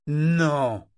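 loud firm refusal; authoritative no. A narrator's reading. Grandmother refusing the girl firmly. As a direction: shut her down. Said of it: "d'un ton autoritaire".

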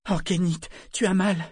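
high, alarmed cry of concern; tearful panic. A narrator's reading. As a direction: rush to him, distraught. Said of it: "en larmes et l'air affolé".

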